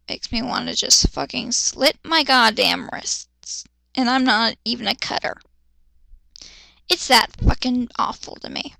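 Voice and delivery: normal talking voice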